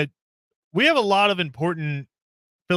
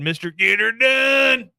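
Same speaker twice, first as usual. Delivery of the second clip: catchphrase voice; 8 semitones higher, 4 dB louder, slower than usual. Retold or imitated. Mocking Larry's catchphrase.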